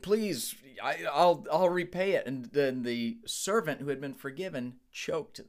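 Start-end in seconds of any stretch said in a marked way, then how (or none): 0.0-2.3 higher, louder, pleading "Please"; pleading debtor. Retold or imitated. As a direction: beg for more time, a touch desperate. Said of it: "this other man begged".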